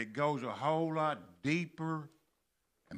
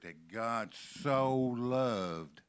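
very slow, "so" stretched long and strong; stress on SO; much slower than usual. Shown as a demonstration. Preacher urging it into our hearts, stress on SO. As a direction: draw "so" right out. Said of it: "that word so".

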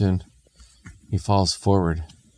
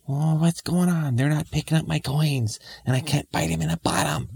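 whining imp; 10 semitones higher than usual. Acted. Imp thinking nobody picks up coins, high whine. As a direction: whine like a thwarted little imp.

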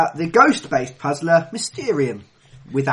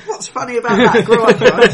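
high, loud laughing protest; 6 semitones higher, 11 dB louder than usual. Real, not acted. Host challenges co-hosts over the balls innuendo, laughing helplessly. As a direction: talk through a fit of giggles.

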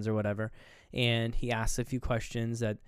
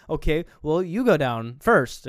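louder, emphatic acted command; retold firm order; 4 semitones higher, 8 dB louder, slower than usual. Distrustful captor sends the prisoner ahead, firmly. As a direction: give a pointed, raised-voice instruction.

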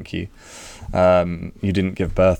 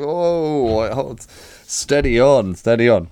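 alarmed slowing down; 5 semitones higher, slower than usual. Retold or imitated. People balking at planned life.

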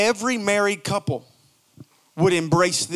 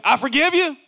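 higher, warm, sincere I forgive you; 6 semitones higher than usual. Shown as a demonstration. Spouse forgiving partner, higher and sincere. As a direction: forgive them openly and kindly. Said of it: "It's the difference between".

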